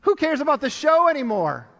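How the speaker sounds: loud outraged plea; inner shout. Retold or imitated. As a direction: burst out in protest. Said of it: "I was thinking".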